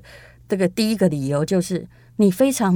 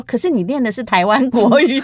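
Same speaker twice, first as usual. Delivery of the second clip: quoting teacher; 5 semitones higher, 4 dB louder than usual. Retold or imitated. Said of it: "老师说".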